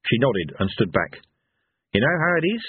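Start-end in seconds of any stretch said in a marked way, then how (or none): none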